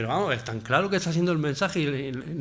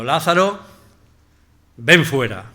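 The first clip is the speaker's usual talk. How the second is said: a loud commanding call, "ven" hit hard; voice of authority; 7 dB louder, slower than usual. Acted. Jesus at Lazarus's tomb, a loud authoritative command. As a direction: order him out with full authority. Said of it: "esa voz de autoridad".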